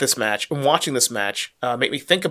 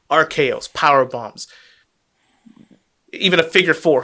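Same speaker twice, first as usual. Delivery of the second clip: normal talking voice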